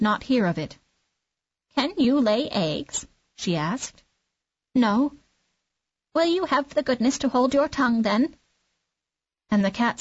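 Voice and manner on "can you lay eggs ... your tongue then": fussy clucking hen. Acted. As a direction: cluck at him like a bossy hen. Hen questions the duckling.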